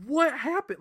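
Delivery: high-pitched baffled outcry; mock bewilderment. Retold shock at missing scene.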